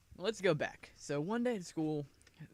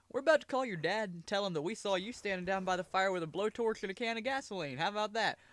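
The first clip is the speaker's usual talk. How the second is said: lightly higher mock firefighter; teasing firefighter; 6 semitones higher than usual. Firefighters teasing the boy, lightly voiced mock threat. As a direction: tease him with the threat.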